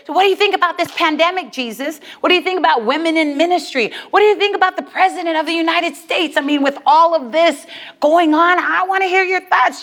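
fast, rattled-off questions; rapid chatter. Acted. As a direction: fire off questions to dodge the subject. Woman chattering to change the subject.

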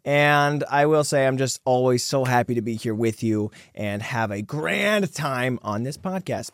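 ordinary talk

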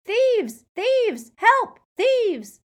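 very high, loud cries of alarm; crying out. A narrator's reading. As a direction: shout the alarm at the top of your voice. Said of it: "brandishing the sword and crying".

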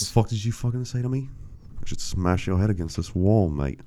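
mock threat in an accidental Australian accent; botched British accent, Australian. Offended guide threatening the host, mock Australian-sounding accent. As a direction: imitate an offended Brit, slipping into Australian. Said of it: "I don't know why he's Australian now".

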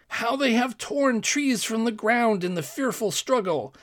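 high-pitched wonder at the sight; amazed exclamation. A narrator's reading. Courtier finding the dead monsters, exclaiming in amazement. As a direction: exclaim it, pitch lifted throughout. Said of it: "exclaimed one of the courtiers".

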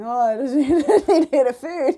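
high, loud whiny voice breaking into a laugh; mock hungry whine. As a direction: ham up a hungry, foggy-headed whinge through laughter. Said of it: "these people that are fuzzy by mid-morning tea going".

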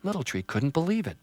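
usual speaking voice

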